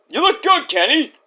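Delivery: high-pitched mock compliment; put-on wrestler voice. Page tells Kenny he looks good, high mock voice. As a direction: lift it high, playfully imitating him.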